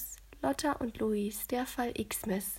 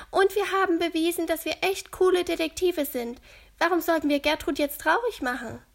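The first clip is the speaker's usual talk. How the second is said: leicht gespielte Mädchenstimme, höher und lauter; Lotta voiced; 6 semitones higher, 7 dB louder, faster than usual. A narrator's reading. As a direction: als eifriges Mädchen Lotta, etwas höher. Lotta's voice in the story. (Lotta schlägt den Freunden vor, helle Kinderstimme)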